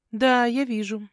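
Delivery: drawl-free mockery, pointed; mocking. Visitor looking at the dishevelled heroine, mocking. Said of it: "насмешливо глядя на меня".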